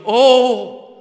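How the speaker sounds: a high, loud, drawn-out anguished oh; loud cry of oh. Acted. Preacher acting the Lord's exclamation, loud high anguished oh. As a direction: cry out the oh from the heart. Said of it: "The Lord is crying out with this "oh".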